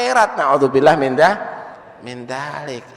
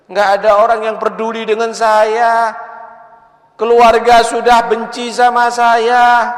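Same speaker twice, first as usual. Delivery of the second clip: pleading beggar; 6 semitones higher, 5 dB louder than usual. Acted. (pengemis memohon makan kepada orang kaya, memelas)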